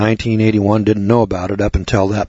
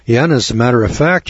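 usual speaking voice

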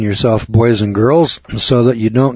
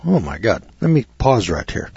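ordinary delivery

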